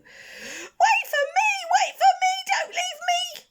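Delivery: very high, slow, desperate wail. Acted. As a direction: beg the others not to leave him. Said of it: "wailed the youngest".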